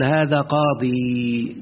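هذا قاضي drawn out very slowly, holding the final ي; slow model reading of قاضي; pausal ya demonstrated. Shown as a demonstration. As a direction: stretch قاضي as the allowed form. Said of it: "ويجوز أن تقف بالياء".

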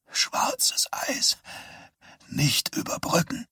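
dazed whisper. Acted. Dazed boy after head injury.

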